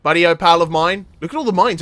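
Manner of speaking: ordinary delivery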